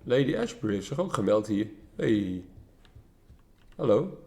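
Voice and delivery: ordinary delivery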